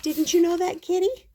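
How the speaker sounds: high, sing-song question; mock-innocent question. A narrator's reading. Narrator needling Kitty about the bath, mock-innocent. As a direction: ask it way up high.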